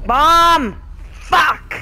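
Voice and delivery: high loud yell at a bomb; alarmed shout. Real, not acted. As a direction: scream the warning in panic. Sudden bomb in game.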